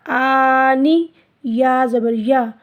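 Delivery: आनिया read slowly, vowels short; slow recitation. Shown as a demonstration. Teacher building the word for pupils, slowly. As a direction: spell out आनिया syllable by syllable. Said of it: "इस तरह लंबा नहीं करेंगे".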